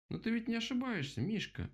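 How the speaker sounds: high-pitched protesting rabbit; objecting. A narrator's reading. Rabbit disputing his bear friend's words, high-pitched objection. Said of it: "возразил кролик".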